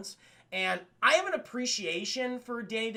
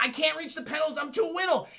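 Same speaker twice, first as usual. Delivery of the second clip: put-on much higher small-boy whine; high child voice; little kid voice; 7 semitones higher than usual. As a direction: pitch it up like a tiny kid.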